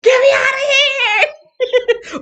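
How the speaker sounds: high, slow, laughing mock scream; panicked shout. A visitor trapped in her busy brain, panicked laughing scream. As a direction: shriek to be let out, half laughing. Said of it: "you'd be like".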